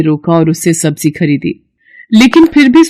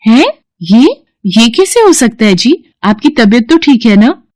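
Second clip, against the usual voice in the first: higher, startled and worried; wife in disbelief; 5 semitones higher than usual. Acted. Wife cannot believe the magic basket, startled and worried. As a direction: stammer out your disbelief.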